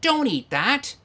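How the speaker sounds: mock yell across a table; imitated yelling wife. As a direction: call it out like a nagging wife. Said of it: "my wife is across the table yelling".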